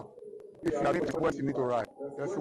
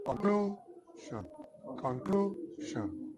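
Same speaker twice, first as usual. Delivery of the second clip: "Conclusion" slow and high, middle syllable stretched and raised; stressed middle syllable; 7 semitones higher, much slower than usual. Shown as a demonstration. Teacher demonstrating stress to students, high drawn-out middle syllable in "Conclusion". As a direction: lift and lengthen the second syllable of "Conclusion". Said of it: "The part that increase the sound".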